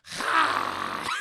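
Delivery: loud hissy breath out; forceful exhale. Retold or imitated. Desiree unleashing pink toxin, loud forceful exhale. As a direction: imitate blowing toxin from the mouth.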